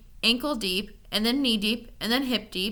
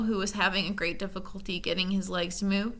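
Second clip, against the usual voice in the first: ordinary delivery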